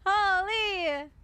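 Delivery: a light Canadian "Holy". Shown as a demonstration. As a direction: give a Canadian "Holy" with a drop at the end.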